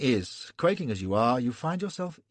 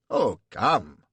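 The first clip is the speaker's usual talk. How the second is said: affected protest; 6 dB louder than usual. A narrator's reading. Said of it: "cries the prince".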